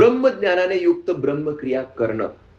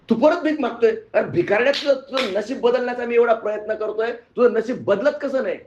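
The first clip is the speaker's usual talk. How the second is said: higher-pitched, mildly exasperated scolding; 6 semitones higher than usual. Acted. Arjuna finds the beggar begging again, exasperated. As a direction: scold in raised-pitch disbelief.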